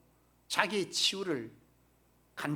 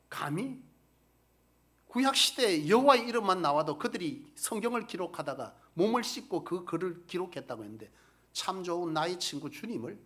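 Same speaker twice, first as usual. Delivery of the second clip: ordinary delivery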